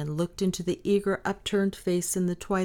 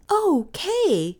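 put-on high, louder little-girl outburst; sudden excited cry; 12 semitones higher, 6 dB louder than usual. A narrator's reading. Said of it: "she cried suddenly".

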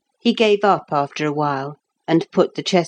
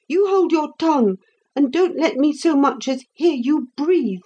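high, sharp scolding nurse; the angry nurse; 8 semitones higher than usual. A narrator's reading. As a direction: scold the boy, pitched well up. Nurse voiced, scolding boy.

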